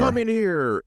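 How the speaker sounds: boss calling out. Retold or imitated.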